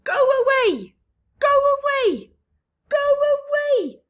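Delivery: high, loud shrieking go away; go-away bird shouting. Acted. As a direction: shriek it at every visitor.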